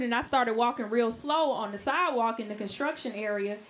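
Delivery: ordinary talk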